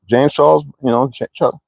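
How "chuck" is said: ordinary talk, nothing marked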